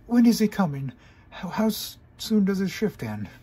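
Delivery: high, loud hurried questions; suddenly anxious; high panicky pony; panicked. Acted. Trixie panicking.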